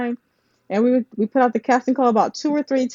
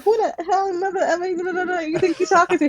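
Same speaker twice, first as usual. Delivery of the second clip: imitating a heated back-and-forth, pitched higher; half-playful fake argument, voiced high; mock heated quarrel; 9 semitones higher than usual. Two friends bickering in front of a confused actress, mock heated. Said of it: "this is how we talk to each other".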